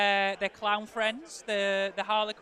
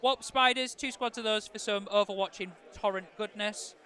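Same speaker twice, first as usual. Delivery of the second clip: ordinary talk, nothing marked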